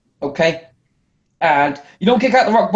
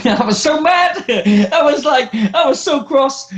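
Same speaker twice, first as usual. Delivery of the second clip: laughing; 7 semitones higher, slower than usual. Real, not acted. Laughing while recalling anger.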